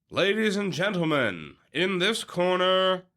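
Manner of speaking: drawn-out, big boxing-style introduction; ring announcer. Acted. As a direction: stretch the name like a ring announcer.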